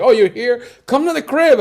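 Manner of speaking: imitating a friend's eager welcome; excited invite. Friend in Bogota inviting him home, excited.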